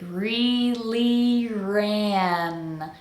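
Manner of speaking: REALLY RAN with nice big vowels, drawn out very slowly. Shown as a demonstration. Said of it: "Make sure your vowels are nice and big".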